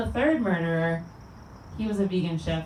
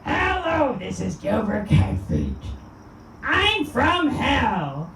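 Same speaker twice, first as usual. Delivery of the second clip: a higher, much slower grating comedian yell; Gilbert Gottfried impression; 4 semitones higher, much slower than usual. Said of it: "I'm gonna end with Gilbert Gottfried".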